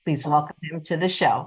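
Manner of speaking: ordinary talk, nothing marked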